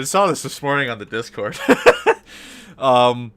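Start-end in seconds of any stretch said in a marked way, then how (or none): 1.6-2.7 high squeal laugh. Real, not acted. Reacting to Discord post.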